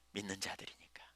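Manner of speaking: soft, breathless whisper. Real, not acted. Preacher whispering to the congregation why they are different. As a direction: let it fall to a whisper.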